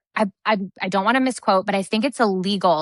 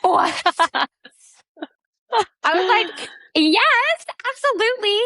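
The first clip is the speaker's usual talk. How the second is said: high-pitched, delighted squeal of agreement; thrilled disbelief; 12 semitones higher than usual. Retold or imitated. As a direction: squeal it, far above your usual voice. Retold thrilled reaction to husband.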